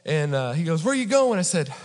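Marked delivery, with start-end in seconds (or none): 0.8-1.4 high, loud mock demand; imitated angry cop. Officer questioning a pulled-over driver, mock angry. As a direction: challenge him like an exasperated officer.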